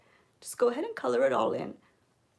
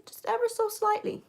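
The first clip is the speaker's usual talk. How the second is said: "ever" lifted much higher in a sing-song; lilting emphasis; 11 semitones higher than usual. Shown as a demonstration. Makeup tutor coaxing viewers to go light, high lilting voice. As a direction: float the whole phrase up high to stress how little. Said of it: "repeats phrase to show lightness".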